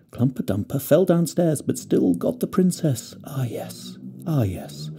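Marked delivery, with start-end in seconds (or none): 3.2-4.9 hushed, breathless whisper. Acted. Fir tree marvelling at the story, soft whisper. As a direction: breathe it out to yourself in wonder.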